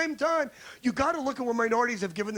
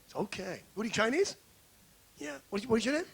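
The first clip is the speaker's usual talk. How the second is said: ordinary talk, nothing marked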